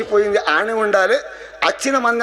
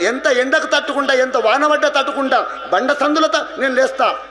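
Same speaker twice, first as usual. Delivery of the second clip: higher-pitched declaration as the tree; lightly voiced fig tree; 6 semitones higher than usual. Fig tree telling people it withstands hardship, lightly acted declaration. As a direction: declare endurance in a raised pitch. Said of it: "అది రాగి చెట్టు ఏమంటది అంటే".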